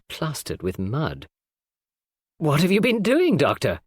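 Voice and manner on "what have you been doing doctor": higher and louder startled question, dropping on Doctor; cried out. A narrator's reading. Mrs Cavendish seeing the mud-plastered doctor, startled cry. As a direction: call out at the muddy sight. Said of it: "cried Missus Cavendish".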